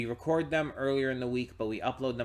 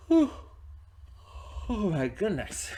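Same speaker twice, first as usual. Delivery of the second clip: yawning; 10 semitones higher than usual. Real, not acted. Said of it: "Sorry for all the yawning".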